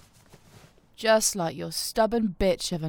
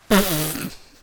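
mocking laugh; 3 semitones lower, 6 dB louder than usual.

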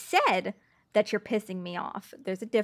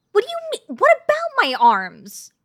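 shoots up high and loud in outraged surprise; what, my arms?, hit high; startled, defensive outrage, pitched way up; indignant disbelief; 11 semitones higher, 9 dB louder than usual. Acted. Indignant at remark about arms.

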